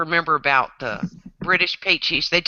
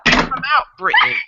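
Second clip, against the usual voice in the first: high mock British through closed teeth; pinched-teeth British; 9 semitones higher than usual. Shown as a demonstration. Coaching friends on sounding British, high pinched British accent. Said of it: "just pinch your teeth together".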